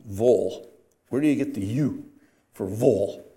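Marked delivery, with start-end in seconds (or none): 0.0-0.7 "vol" for the ending -ville; local pronunciation. Shown as a demonstration. Preacher mocks how Georgians say words ending in ville, local pronunciation. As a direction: reduce -ville to a rounded "vol". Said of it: "is pronounced vol".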